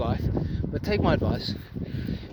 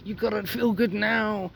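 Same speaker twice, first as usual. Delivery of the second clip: a lightly voiced seductive mind, slower and coaxing; tempting inner voice; 3 semitones higher, 3 dB quieter than usual. The mind tricking a viewer, voiced as a slow coaxing tempter. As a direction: play the sly voice in your head luring you back. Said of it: "Your mind is gonna trick you and say".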